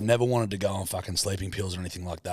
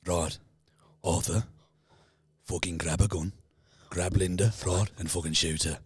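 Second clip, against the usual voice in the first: Peaky Blinders impression; 6 semitones lower, 3 dB quieter than usual. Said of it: "I can do Tommy, Arthur, and Alfie".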